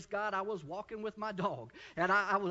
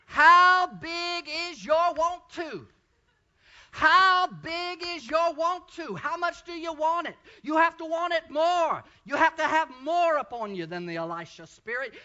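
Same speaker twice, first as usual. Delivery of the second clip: loud, rousing pulpit cry; full-force preaching; 8 semitones higher, 11 dB louder, slower than usual. Acted. Preacher urging the church to want more, loud. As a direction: drive it home to the church.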